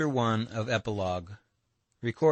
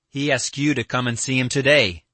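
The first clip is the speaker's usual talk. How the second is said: louder, higher, blurted out; sudden blurt; 5 semitones higher, 7 dB louder than usual. A narrator's reading. Alyosha delivering the message to Katya, blurted suddenly. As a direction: blurt it out all at once. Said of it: "he blurted out suddenly".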